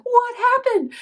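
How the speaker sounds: high, loud shocked question; alarmed. Retold or imitated. Teacher sees a student crying from a spicy pepper, high and alarmed. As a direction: sound startled and worried. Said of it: "I'm like".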